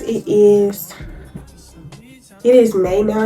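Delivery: usual speaking voice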